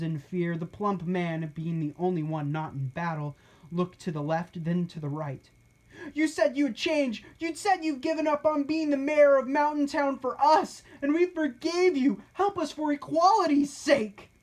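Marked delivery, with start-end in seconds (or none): 6.1-14.3 high, raised pleading voice; pleading captive. A narrator's reading. Captive begging the former mayor for help, high and urgent. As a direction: beg him to keep his word.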